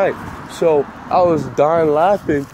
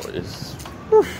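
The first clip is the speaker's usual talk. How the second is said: whoosh sound; 3 dB quieter than usual. Retold or imitated.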